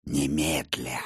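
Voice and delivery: soft, low menacing vampire. Acted. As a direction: speak as a cold, scornful vampire. Vampire voiced by narrator.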